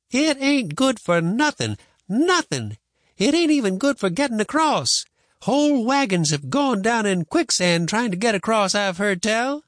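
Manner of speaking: high, emphatic grandma voice; argumentative grandma. Acted. Grandma dismissing boy's raft, emphatic. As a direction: shoot the idea down emphatically.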